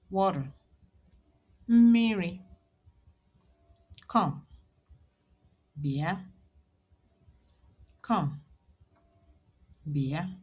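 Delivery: normal talking voice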